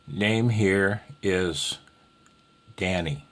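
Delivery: very slow, evenly spaced words; deliberate demo pace; slow example phrase. Shown as a demonstration. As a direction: lay out each word of the call template. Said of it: "if you wanna use the word is".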